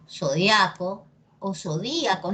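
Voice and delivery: zo-DIA-co, then zo-DÍ-a-co; two accepted stresses. Shown as a demonstration. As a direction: Zodiaco on the A, zodíaco on a leaned-on Í. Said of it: "depende de ti cómo lo quieras decir".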